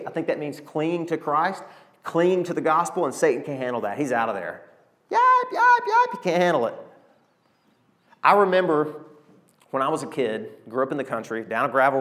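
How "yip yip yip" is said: sharp, very high yips like a scared small dog; mock dog yips, squeaky and high; yapping little dog. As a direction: yelp it out like a dog running off. Dog yapping at preacher's words.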